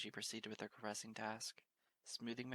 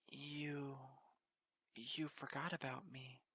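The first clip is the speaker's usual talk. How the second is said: higher, slow squeaking near tears; squeaky tearful voice; 4 semitones higher, slower than usual. Acted. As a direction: squeak it out through a lump in the throat. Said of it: "The next voice squeaked forth".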